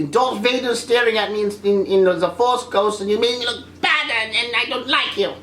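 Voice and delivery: mock petulant anger; Kylo Ren. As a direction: act out a sulky villain tantrum. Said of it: "Kylo Ren should have been angry".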